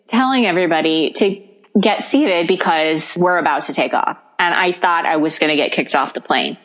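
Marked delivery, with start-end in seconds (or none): none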